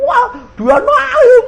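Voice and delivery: high, laughing disbelief over a crying caller. Retold or imitated. Pastor laughing as the mother cries on the phone.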